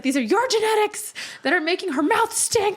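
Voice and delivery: hammed-up dad blaming the mom's genes; voicing angry dad. Dad mad at mom, voiced.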